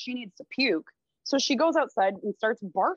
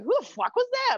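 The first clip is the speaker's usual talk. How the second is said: startled question; 13 semitones higher than usual. Retold or imitated. Kelly wakes, sees stranger leave.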